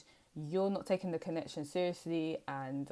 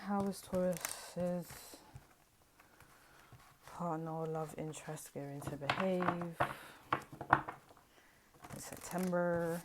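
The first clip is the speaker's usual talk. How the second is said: very slow, stretched-out question to the cards; intoned card question; slower than usual. Real, not acted. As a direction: draw out each phrase with long pauses between. Intones the question while shuffling cards.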